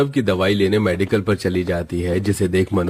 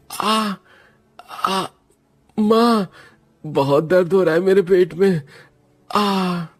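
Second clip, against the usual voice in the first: crying out in pain; 10 semitones higher, slower than usual. Acted. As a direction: call for mother through the pain. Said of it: "Saurabh के चिल्लाने की आवाज".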